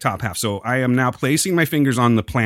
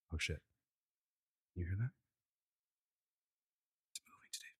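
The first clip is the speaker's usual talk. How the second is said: breathless startled whisper; hushed, scared whisper; 6 semitones lower, 24 dB quieter, faster than usual. Real, not acted. Planchette moves, startled hush.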